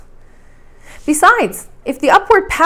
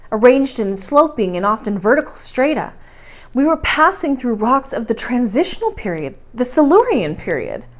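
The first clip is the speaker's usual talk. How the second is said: ordinary delivery, nothing marked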